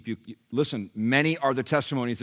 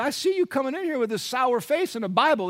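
much higher put-on voice of another patient; blunt challenger; 4 dB louder than usual. Fellow patient confronting sour-faced Nelson, blunt high-voiced challenge. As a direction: challenge him in a high, acted voice.

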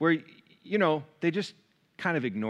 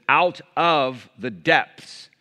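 slow, louder drawn-out Out of the depths; scripture reading; 7 dB louder, much slower than usual. Acted. Preacher reading the psalm's opening to church, slow and proclaiming. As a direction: stretch the opening phrase like a proclamation.

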